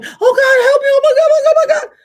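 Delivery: very high, loud desperate mock cries for help; retold screaming. A stranger screaming for help, imitated shrill cries. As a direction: wail like someone in terror.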